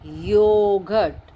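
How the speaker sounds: Yogurt drawn out slowly, the y clear at the start; slow model word. Shown as a demonstration. Teacher to young pupils, slowly modelling the word. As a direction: stretch yogurt slowly so children hear the y. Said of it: "तो वाई को पढ़ते हैं या".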